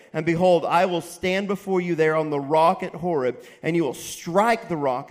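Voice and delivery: loud, emphatic sermon delivery; full-voiced pulpit preaching. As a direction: preach it out to the congregation. Preaching God's words loudly.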